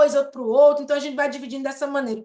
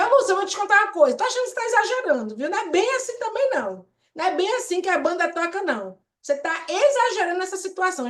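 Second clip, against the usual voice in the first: higher, fast, dismissive scolding; 5 semitones higher, faster than usual. Shown as a demonstration. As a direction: brush her off quickly, pitched up and preachy. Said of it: "em vez de eu dizer". (bronca rápida e aguda, desdenhosa)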